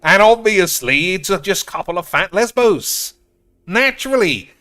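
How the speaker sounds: loud, high-pitched mock realisation; over-the-top sarcastic outburst, high and loud; sarcastic rant. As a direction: exclaim it with exaggerated, soaring sarcasm. Sarcastic rant about the card.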